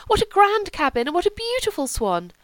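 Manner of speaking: high, society-lady gush; gushing praise. Acted. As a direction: fawn over the cabin. Guests gush over the cabin.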